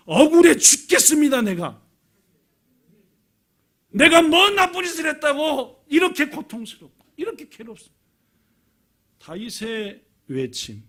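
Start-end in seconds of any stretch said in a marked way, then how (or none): 0.1-1.8 loud, high cry of injustice; aggrieved lament. Acted. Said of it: "탄식이었습니다". 3.9-8.0 high, loud protest of innocence; aggrieved lament. Acted. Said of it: "탄식이었습니다".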